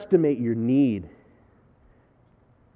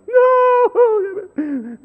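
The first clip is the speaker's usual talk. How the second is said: shrill high panicked no, much louder; imitating wife's cry; 6 dB louder than usual. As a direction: squeal it like the wife rushing out to stop you. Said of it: "Julie hears the weed whacker start".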